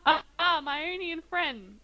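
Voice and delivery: a hint of an effusive stranger; friendly greeting. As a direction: greet a fellow foreigner like a friend. Dorian greets fellow Ionian.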